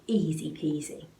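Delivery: ordinary delivery, nothing marked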